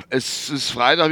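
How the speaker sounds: normal talking voice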